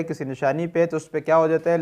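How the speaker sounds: normal talking voice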